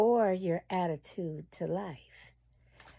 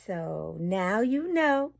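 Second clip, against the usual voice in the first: normal talking voice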